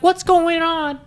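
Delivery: high mock mom voice. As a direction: squeak it like a curious mom. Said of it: "she's like".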